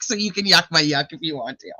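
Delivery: ordinary delivery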